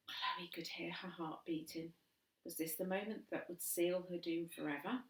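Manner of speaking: soft, hushed suspense; suspense whisper. A narrator's reading. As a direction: draw them in breathlessly. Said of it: "suspense whisper narration".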